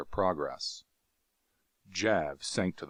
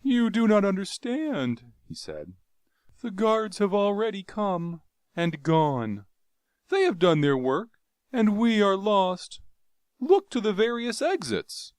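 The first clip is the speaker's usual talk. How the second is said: mournful resignation; 14 semitones higher, 6 dB louder than usual. Acted. Jav telling Carthoris they are doomed, mournful. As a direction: tell him all is lost. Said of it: "shook his head mournfully".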